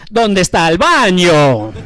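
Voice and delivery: slow, hammed-up Italian accent, laughing through it; Super Mario Italian accent. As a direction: imitate dad's thick Italian accent mangling "¿Dónde está el baño?". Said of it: "It's a Super Mario Brothers accent".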